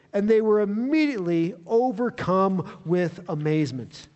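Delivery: ordinary delivery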